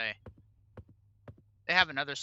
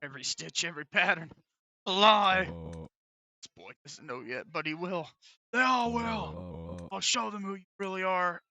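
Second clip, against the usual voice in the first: menacing villain voice; 4 semitones higher, 4 dB louder than usual. Pretend. Voices villain threatening Gary.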